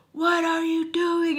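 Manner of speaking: high, drawn-out mock yell; stretched, slow-motion imitation of a yell; retold yelling. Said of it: "my husband was yelling at me".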